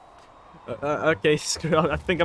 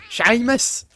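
put-on drunk Irishman; 5 semitones higher, 6 dB louder than usual. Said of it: "Say it like a drunk Irishman".

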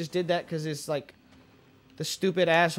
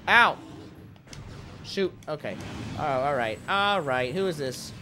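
normal talking voice

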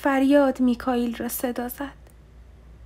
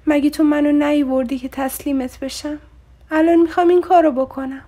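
louder, pleading through tears; crying; 3 semitones higher, 7 dB louder than usual. A narrator's reading. Woman to her lover, speaking through tears. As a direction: cry out the words as she breaks down. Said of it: "در میان گریه گفتم".

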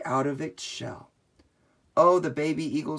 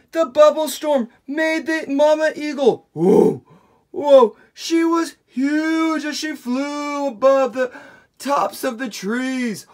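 high, loud, amazed telling; astonished; 11 semitones higher, 10 dB louder than usual. Acted. Storyteller amazed the bubbles made everything huge, high and loud. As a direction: marvel at everything grown huge.

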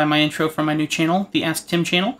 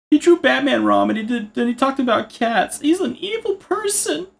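mock angry viewer; 8 semitones higher than usual. Mock commenter outraged that he drew Batman wrong. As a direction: complain like an offended commenter.

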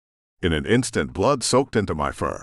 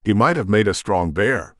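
ordinary delivery

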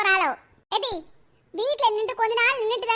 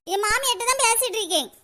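usual speaking voice

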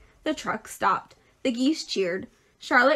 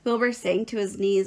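ordinary delivery

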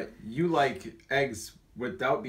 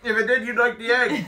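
laughing; 9 semitones higher, 7 dB louder than usual. Real, not acted. Laughing retort.

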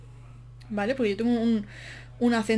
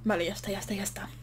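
quiet, breathless recovery; getting the air back, softly; catching breath; 6 dB quieter, faster than usual. Real, not acted. (recuperando el aliento, en voz baja)

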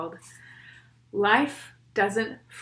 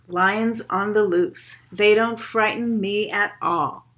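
put-on steady rhythmic poem read for kids; 5 dB louder than usual. Reads poem aloud to children.

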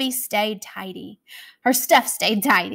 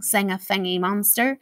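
plain reading voice

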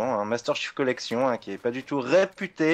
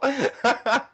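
high laugh; 9 semitones higher than usual. Real, not acted. Continues laughing at friend.

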